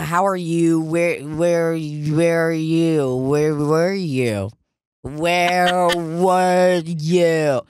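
put-on very slow, stretched-out drunken drone; slurred drunk imitation. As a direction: drag every word out like a sloppy drunk. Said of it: "guy is clearly inebriated".

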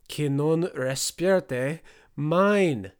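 heavily stretched words, insisting loudly; foreign bellow. A narrator's reading. One-eyed man pleads with the room, bellowing slowly.

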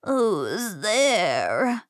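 wavering storybook grandma; old granny voice; grandma calling. A narrator's reading. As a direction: call out from the sickbed. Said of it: "called Grandma from her bed".

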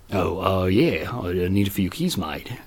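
low, slow, very soft Australian customer; hesitant, subdued imitation of an Australian; Australian accent. Surprised customer answers worker after a pause, quiet Australian accent. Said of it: "Remember the Australian guy".

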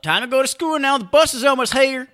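high loud mom call; hammed-up mom hurrying kid; parent voice. Joins the mom voice.

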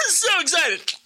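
high, slow, squealing with laughter; excited squeal. Real, not acted. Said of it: "He screamed real loud on that one".

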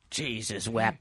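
low, soft, slow villain line; menacing movie quote. Retold or imitated. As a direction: murmur it low like the horror villain.

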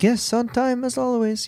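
high-pitched imitation of an Italian accent. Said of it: "in that wonderful Italian accent of his".

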